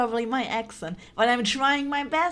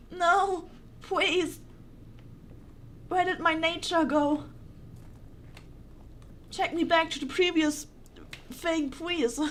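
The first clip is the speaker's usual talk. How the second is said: higher, pleading whine at the game; half-mock panic, higher and pleading; whiny pleading; 5 semitones higher than usual. Real, not acted. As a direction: beg to be taken back, half-joking. Begs the game to go back.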